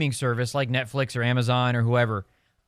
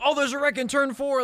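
higher-pitched mock race call; excited live commentary; 10 semitones higher than usual. Race commentator breaking news of a wreck to viewers, excited. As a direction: call out a crash as it happens.

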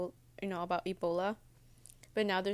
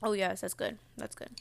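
ordinary talk, nothing marked

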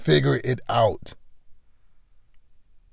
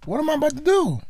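startled realization; 4 dB quieter than usual. Retold or imitated. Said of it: "And you like".

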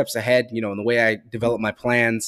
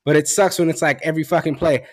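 ordinary talk, nothing marked

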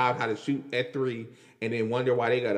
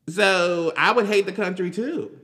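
ordinary talk, nothing marked

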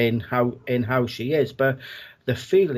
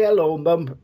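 a higher, louder call across the house; 4 semitones higher, 4 dB louder than usual. Retold or imitated. Greeting late mum around the house, a loud cheerful call. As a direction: call out to her at the top of your voice. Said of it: "top of, top of my voice".

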